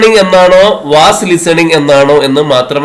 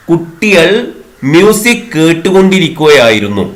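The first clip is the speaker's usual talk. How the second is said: usual speaking voice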